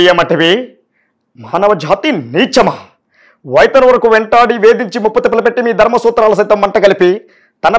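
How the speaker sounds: grand mythological film dialogue, declaimed; Jr NTR dialogue impression. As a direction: thunder out an epic movie monologue. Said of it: "ఇప్పుడు junior NTR sir ఉన్నారు".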